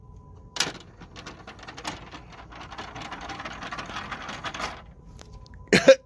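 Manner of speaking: high breathless giggle of delight; delighted laugh. Real, not acted. Collector delighted by his toy trick, giggling high. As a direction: laugh with pure collector's joy.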